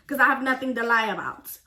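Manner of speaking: ordinary talk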